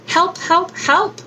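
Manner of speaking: high pleading calls for help, in a slightly other voice; crying for help. A narrator's reading. The stuck Little Blue Truck cries high for help. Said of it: "cried the Little Blue Truck".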